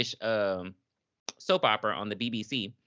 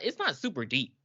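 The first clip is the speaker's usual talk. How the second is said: normal talking voice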